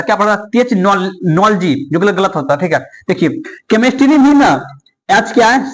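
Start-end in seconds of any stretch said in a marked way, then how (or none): 0.5-1.7 टेक्नोलॉजी said slowly with a ch sound; slow mispronounced टेक्नोलॉजी, "technolochy" with ch; टेक्नोलॉजी deliberately wrong, h sounded as ch; wrong pronunciation. Shown as a demonstration. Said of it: "अगर एच silent नहीं होता तो टेक्नोलॉजी पढ़ता".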